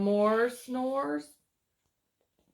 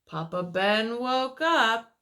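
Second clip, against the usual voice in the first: story narration; 6 dB louder than usual. A narrator's reading. Reads picture book aloud.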